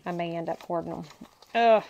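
normal talking voice

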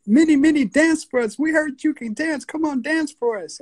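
high, eager coaxing; imitated pleading neighbours. Neighbours urge his mom to dance for them, high pleading. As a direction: beg her in a high-pitched voice.

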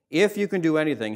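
lightly acted appeal, hitting "If"; imploring. Father asks Christ for compassion, imploring. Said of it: "he implores Christ".

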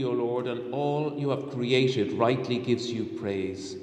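normal talking voice

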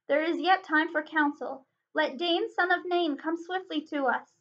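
ordinary delivery

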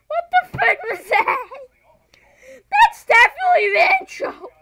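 very loud, high-pitched shrieks; real frantic screaming. Real, not acted. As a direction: scream in shock at the game. Panics at the game, then amazed.

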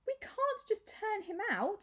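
high desperate plea. A narrator's reading. Maya pleading for Clovis, high and desperate. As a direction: plead as if it matters most. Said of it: "said Maya desperately".

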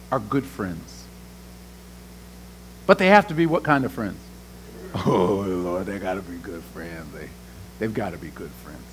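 low hushed preaching; deep hush. Real, not acted. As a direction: drop way down and lean on it.

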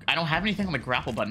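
ordinary delivery

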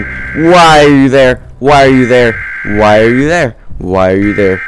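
loud high yell fading down; shouting. Real, not acted. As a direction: yell it, then let it die. Yelling at monster in view.

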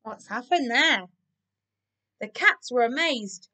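ordinary delivery

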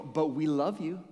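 drawn-out, tacked-on declaration of love; unconvincing affection. Acted. Church to someone it just clobbered, unconvincing affection. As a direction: stretch it out as an unconvincing afterthought. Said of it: "It's not very believable".

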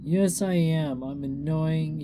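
upset, whiny contradiction; self-pitying whine. Acted. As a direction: insist on it, wallowing in self-pity. Drunk pony wailing in self-pity.

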